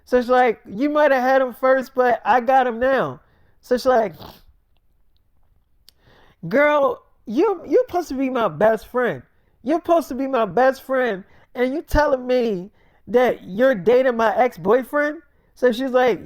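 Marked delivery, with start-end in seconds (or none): 0.7-3.2 higher, defiant retort; defiant comeback. Acted. Acted quarrel between two women. 6.5-15.2 high-pitched acted outrage; indignant best friend. Acted quarrel between best friends.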